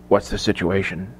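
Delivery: low, hushed question to the soldiers; hushed whisper. A narrator's reading. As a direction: drop to a confidential murmur. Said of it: "He moved to the soldiers and whispered".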